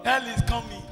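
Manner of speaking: low, soft, ominous echo; hushed warning. Real, not acted. Preacher repeats warning of hell, hushed warning. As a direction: drop right down to a quiet warning.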